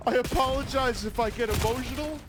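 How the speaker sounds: high mocking whiny imitation of her apology. Mocking the streamer's teary apology in a high whiny voice. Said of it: "I didn't see her say that".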